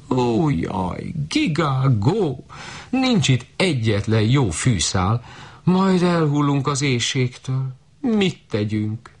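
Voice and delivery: high, slow goose lament; high goose voice, despairing; wailing geese. A narrator's reading. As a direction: wail like starving geese.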